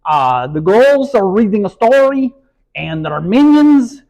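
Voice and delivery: high, loud cartoon villain accent; Gru. Shown as a demonstration. Demonstrating the voice used while reading a story, Gru impression. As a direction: announce it in a big cartoon villain voice. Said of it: "And that's Gru".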